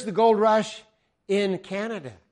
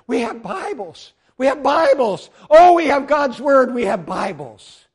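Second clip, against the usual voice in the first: high, loud jubilant cry, easing at the end; acted overjoyed shouting; tearful rejoicing; 7 semitones higher, 10 dB louder than usual. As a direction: exult like an old man dancing over a treasure. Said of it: "with tears streaming down his face, dance around".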